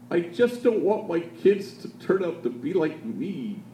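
man at the microphone confesses through tears, slow and high; tearful. Retold or imitated. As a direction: let the words catch as if crying. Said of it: "through his tears".

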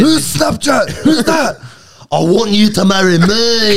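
very high-pitched mock contestant voice; hammed-up squeaky proposal, dragging out the end; wog Bachelorette contestant. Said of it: "like, a wog on Bachelorette".

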